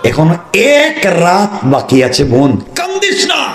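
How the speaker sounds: drawn-out, rising chant-like call; high consoling cry. Acted. Fatema urges her crying sister not to cry, soaring cry. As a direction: stretch it out and soar high at the end.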